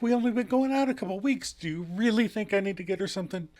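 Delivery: high, quick protest; bargaining. Retold or imitated. Joey bargaining out of a birthday gift, high and rushed. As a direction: plead the case in a hurry. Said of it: "And Joey kinda bargains".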